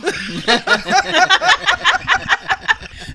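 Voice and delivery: long, loud, high-pitched laughing fit; big laugh. Real, not acted. After confessing to friends she has done so, big laugh. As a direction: burst out laughing hard. Friend admits excusing flirts, cracks up.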